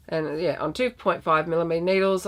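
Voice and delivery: ordinary talk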